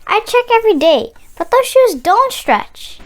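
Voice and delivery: lifted, earnest complaint; child pleading with grandma. Acted. Boy telling grandma the shoes won't stretch, high and earnest.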